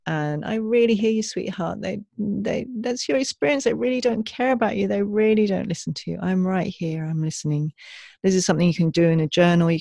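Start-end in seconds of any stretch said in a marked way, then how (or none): 0.4-7.7 put-on gently comforting an upset inner child; compassionate inner parent. Shown as a demonstration. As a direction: speak like a loving parent soothing a little one. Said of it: "each time responding with compassion".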